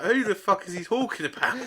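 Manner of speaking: mock confusion at a garbled call; baffled exclamation. On the phone to a drunk friend, loudly baffled.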